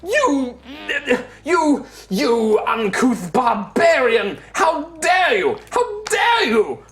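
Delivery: a wailing high-pitched elf, half crying in offence; outraged elf. Acted. Elf shrieks at the barbarian, hammed-up outraged wail. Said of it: "He's just like".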